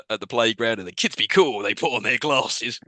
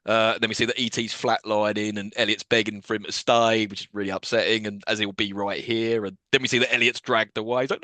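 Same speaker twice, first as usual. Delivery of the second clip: ordinary talk, nothing marked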